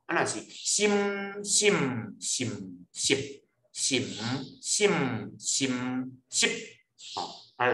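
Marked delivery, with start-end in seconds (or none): 0.4-6.5 心 through eight tones, lips closing each time; closed-lip tone drill. Shown as a demonstration. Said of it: "就喙合快哦".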